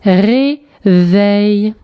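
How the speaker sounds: "Réveil" drawn out as ré, veil; syllable by syllable. Shown as a demonstration. Teacher correcting the syllable exercise, syllable by syllable. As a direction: sound out "Réveil" in two separate held beats. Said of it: "les syllabes où tu entends".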